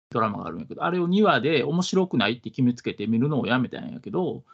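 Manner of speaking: ordinary delivery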